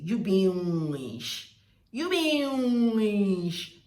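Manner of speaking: loud, drawn-out "weesh" for "witch"; Warri pronunciation. Shown as a demonstration. Showing what Warri people say, loud and drawn out. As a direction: stretch "witch" loudly into a long sh. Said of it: "That is what they say".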